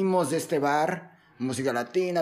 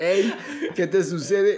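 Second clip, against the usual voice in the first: laughing mock challenge; 4 semitones higher, slower than usual. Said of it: "Así de".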